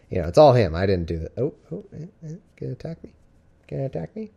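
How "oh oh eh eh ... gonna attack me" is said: teasing the cat. Real, not acted. Cat swatting at her.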